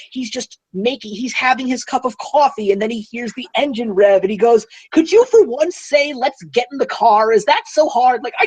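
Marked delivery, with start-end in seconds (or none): 4.9-8.2 higher-pitched, mock-exasperated retelling; exasperated quote. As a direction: quote it like a fed-up wisecrack. Quotes Nightwing's exasperated line.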